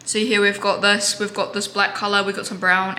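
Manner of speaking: usual speaking voice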